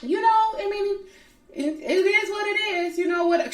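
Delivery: high, airy brush-off voice; dismissive brush-off. Retold or imitated. Friend quoting Monique shrugging off Candace, high dismissive voice. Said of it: "you can tell she's trying to brush you off".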